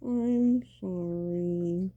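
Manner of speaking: slow, muttered apology to the floor; mock a half-hearted apology from a corner; mumbled sorry. Shown as a demonstration. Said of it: "literally like this".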